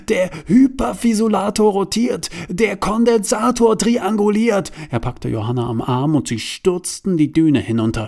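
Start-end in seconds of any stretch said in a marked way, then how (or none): 0.1-4.7 high, louder panicked blurting. Acted. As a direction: spit out the danger in alarm. Panicked explanation before explosion. (Bruno erklärt panisch, hoch und laut)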